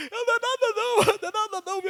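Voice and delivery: high, soothing reassurance repeated; calm the worried wife down; imitating himself comforting his wife; reassuring. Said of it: "eu tentando acalmar ela".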